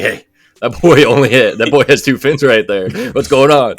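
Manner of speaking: loud, high, amazed call-out; excited pointing at screen. Retold or imitated. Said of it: "I'm like".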